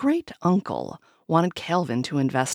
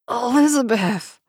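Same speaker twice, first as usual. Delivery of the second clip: imploring; 7 semitones higher, 5 dB louder than usual. A narrator's reading. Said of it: "he implored".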